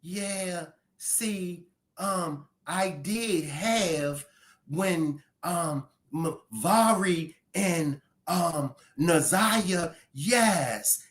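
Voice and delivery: very soft, slow, hesitant imitation; mock a timid answer, softly dragging the words; mock weak-voiced man. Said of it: "Put some bass in your voice".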